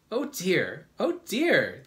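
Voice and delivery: high, loud exclamations; amused bugs exclaiming. A narrator's reading. All the bugs react to James's mistake, high exclamation. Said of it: "There was a roar of laughter".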